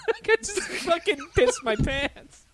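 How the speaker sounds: high, slow words through real laughter; talking through helpless real laughter; laughing hard. Real, not acted. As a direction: crack up while saying it.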